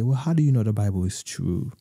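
ordinary talk

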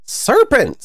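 high, mock scream of accusation; high-pitched screech at modest volume. A narrator's reading. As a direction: cry out high, as a storybook pigeon. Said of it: "screamed the pigeon".

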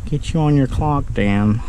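ordinary delivery

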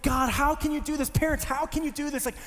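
high, anguished pleading, retold; tearful protest. Crying teenager to God and parents about moving, anguished protest. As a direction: plead upward, hurt and overwhelmed. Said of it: "I'm just crying".

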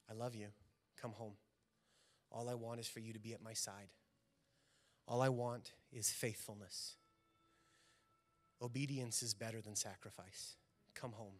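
Jesus calling the lost one home, softly; tender forgiveness. Retold or imitated.

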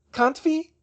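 high, loud, cutting comeback; sharp retort. A narrator's reading. German witch challenged on her refusal, sharp retort. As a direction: throw it back sharply in a German accent. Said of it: "she said sharply".

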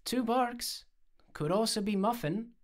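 high-pitched skeptical comeback; contrary, quibbling reply; retort. A narrator's reading. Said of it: "I retorted".

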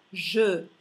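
"je" with a rounded schwa, drawn out; French mute E. Shown as a demonstration. Teacher models French for learners, slow rounded E. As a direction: hold "je" slowly on its rounded vowel.

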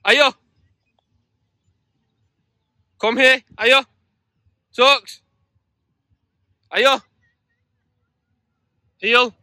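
loud, high command shouts; firm dog commands. Real, not acted. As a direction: bark the orders at the dog. Recall commands to distracted dog.

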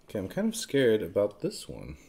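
ordinary talk

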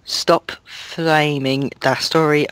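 normal talking voice

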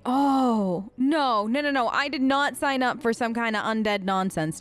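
ordinary delivery

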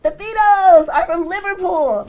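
high, loud, slow mock Scouse; mock Liverpool accent. Host echoing the guest about the Beatles, mock Scouse accent. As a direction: stretch it out in a playful Liverpool accent.

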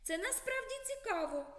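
put-on high, curious little boy. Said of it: "додав допитливий Миколка". (голос допитливого хлопчика, вище)

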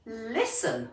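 a hushed, soothing little cricket; soft cricket. Acted. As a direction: coax him gently to listen. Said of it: "said the cricket".

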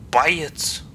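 whispered buy it; whispering. Shown as a demonstration. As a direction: hush the words buy it.

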